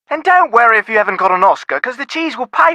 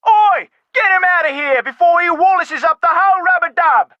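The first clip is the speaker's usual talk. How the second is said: shouted Cockney oi, higher; 7 semitones higher than usual. Acted. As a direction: bellow across the pub in Cockney. Pub owner yells across pub.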